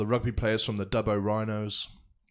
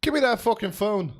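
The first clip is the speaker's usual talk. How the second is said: mock angry demand; 9 semitones higher than usual. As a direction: bark it like a furious dad. Mock angry grab for phone.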